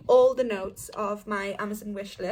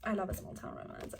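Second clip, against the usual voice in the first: whispered; 2 semitones lower, 11 dB quieter than usual. Real, not acted. Aside to herself after the blurb.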